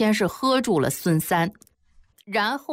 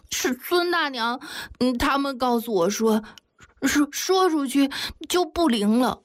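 put-on high-pitched, halting child voice; hesitant little girl; 7 semitones higher than usual. A narrator's reading. Ying'er explaining to Jiang Daxiao, hesitant. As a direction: confide haltingly as a small girl.

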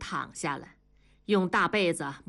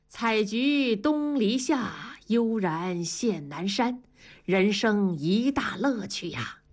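slow, savouring verse recitation; leisurely poetic recital; 2 semitones higher, slower than usual. Acted. Colleague daydreaming of country life, leisurely poetic recital. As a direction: linger over each line of the poem.

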